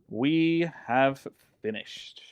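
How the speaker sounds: slow, drawn-out proclamation; grand announcement. Pretend. Streamer announcing finished build to viewers, slow mock-grand proclamation. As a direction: proclaim it word by word, savouring completion.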